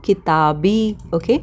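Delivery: ordinary delivery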